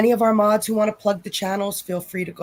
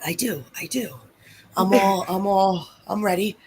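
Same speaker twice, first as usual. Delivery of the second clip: sexy voice. Acted. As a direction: purr it out playfully. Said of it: "[name]'s got her sexy voice on".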